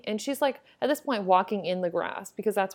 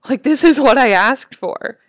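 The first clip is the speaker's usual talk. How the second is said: louder, thrilled inner voice; excited realization; 3 semitones higher, 15 dB louder than usual. Retold or imitated. Retelling the moment a stranger surprised her, excited. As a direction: burst out with delight at the sign. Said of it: "I was like".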